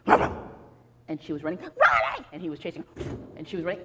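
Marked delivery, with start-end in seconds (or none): 1.6-2.3 high, loud cry; frantic storybook scream; panicked chase yell. Acted. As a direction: yell it while fleeing. Girl screams while being chased.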